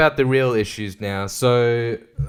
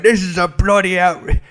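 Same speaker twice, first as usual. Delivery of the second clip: higher, indignant British politician impression; mock British accent; 6 semitones higher, 3 dB louder than usual. Comedian imagining the Prime Minister in parliament, mock British outrage. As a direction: protest in a hammed-up British accent. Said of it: "I keep going for British".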